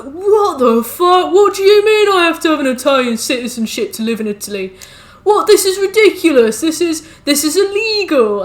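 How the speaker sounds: high, loud, indignant mock outrage; retelling a Brexit voter's outrage at being deported; mock outraged expat. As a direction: ham up his shocked indignation.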